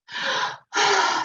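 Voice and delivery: demonstrated sigh of released breath; long sigh. Shown as a demonstration. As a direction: let out a held breath audibly. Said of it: "sighing after a long time".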